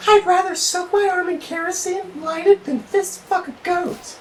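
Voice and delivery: high put-on girly voice; high mock female voice; girl's voice.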